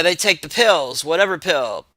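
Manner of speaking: usual speaking voice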